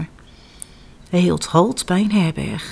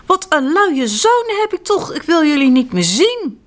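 angry tailor; 8 semitones higher, 3 dB louder than usual. Acted. Angry tailor scolds his sons.

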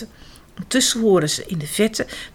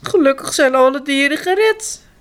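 high, tearful sobbing voice; 11 semitones higher, 4 dB louder than usual. A narrator's reading. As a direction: speak through sobs. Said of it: "zegt de vrouw snikkend". (snikkend praten)

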